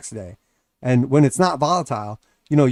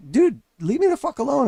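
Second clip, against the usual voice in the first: annoyed brush-off; 12 semitones higher than usual. Retold or imitated. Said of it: "And you're like".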